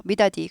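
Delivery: "미다디" with a hard final di; hard North Korean form. Shown as a demonstration. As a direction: keep the d unsoftened in "미다디". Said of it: "부드럽게 말하지 않아요".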